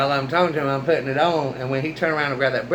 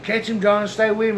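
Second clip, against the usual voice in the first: higher-pitched retold urging from buddies; urgent urging; 7 semitones higher than usual. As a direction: egg the driver on, mock-urgent.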